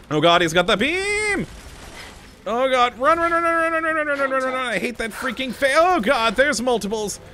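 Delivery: panicked yelling, run run run; frantic shouting while fleeing; yelling while running away. Real, not acted. Fleeing the beam robot.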